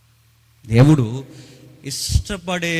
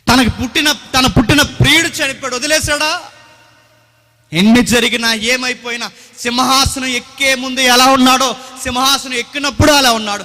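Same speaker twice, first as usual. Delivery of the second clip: loud, higher, rebuking preacher; fiery preaching; 6 semitones higher, 8 dB louder than usual. Acted. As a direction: thunder at the congregation. Preaches at full force.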